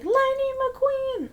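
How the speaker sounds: high, drawn-out cheer; hyping up a friend. Acted. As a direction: stretch it out in a high hype call. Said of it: "always hyping up".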